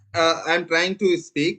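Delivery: very slow, careful words; speaking slowly. Real, not acted. Teacher slows down so students hear over lag, slow. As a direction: stretch every word for a laggy line. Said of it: "मैं थोड़ा अब आराम आराम से बोलूंगा".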